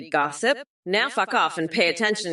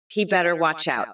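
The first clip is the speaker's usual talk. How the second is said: ordinary delivery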